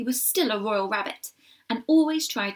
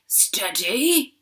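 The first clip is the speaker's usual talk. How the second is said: growl; 5 dB louder than usual. A narrator's reading. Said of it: "growled Nelson".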